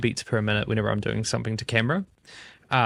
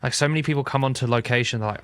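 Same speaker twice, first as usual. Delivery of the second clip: usual speaking voice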